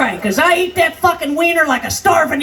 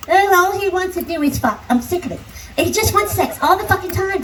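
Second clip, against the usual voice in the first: mock whiny young woman; 4 semitones higher than usual. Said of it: "you bitches complaining".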